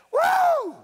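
very high, loud whoop of joy; joyful woo shout. Retold or imitated. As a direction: let out a triumphant woo. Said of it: "I got in my car and I went".

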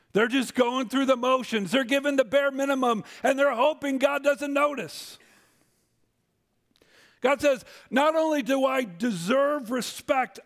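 high, loud preaching about fake butter; preaching at full force. Real, not acted. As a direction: drive it home from the pulpit, high and loud. Preacher voicing God's demand.

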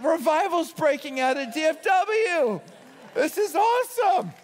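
high, loud rising joy; high, loud cry of delight; thrilled exclamation. Retold or imitated. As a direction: burst out with amazed excitement. Preacher's own thrilled reaction.

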